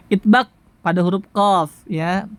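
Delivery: ordinary talk, nothing marked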